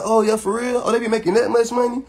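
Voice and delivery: string him along, feigning interest; fake interest. Baiting him to see what he says, feigned eager interest. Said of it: "I'm playing into it to see".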